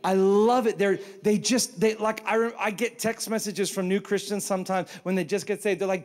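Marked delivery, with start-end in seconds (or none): none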